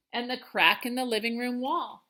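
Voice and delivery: ordinary delivery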